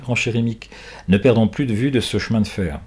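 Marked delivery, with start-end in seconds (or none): none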